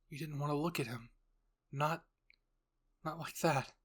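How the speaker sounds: ordinary delivery, nothing marked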